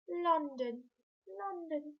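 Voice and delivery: mock posh "London, London"; lightly plummy, upper-class London. Shown as a demonstration. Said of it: "put a really posh voice on".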